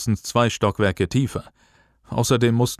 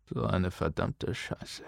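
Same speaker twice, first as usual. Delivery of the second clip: very soft, slow mutter; 3 semitones lower, 12 dB quieter, slower than usual. A narrator's reading. Booker seeing the truth below, muttering softly. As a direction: mutter a curse under your breath, slowly. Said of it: "hörte er sich gleich darauf murmeln".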